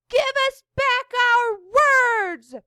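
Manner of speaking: loud high yell for their words back; children yelling. A narrator's reading. Children yell at the pirates to give back their words. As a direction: shout it as the kids demanding. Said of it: "the children yelled".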